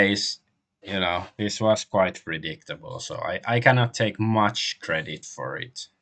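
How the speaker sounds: ordinary talk, nothing marked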